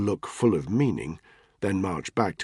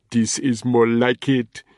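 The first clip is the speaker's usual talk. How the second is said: put-on slow, loud, pleased Nigerian accent; 4 semitones higher, 6 dB louder, slower than usual. Ntoga gloating to his guards in a Nigerian accent. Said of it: "he announced in a marked Nigerian accent".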